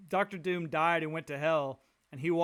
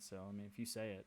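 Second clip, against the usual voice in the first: quick, much softer mumble, giving up; resigned mumble; 8 semitones lower, 14 dB quieter, faster than usual. Retold or imitated. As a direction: trail off fast and quiet, conceding. Guy giving in to God.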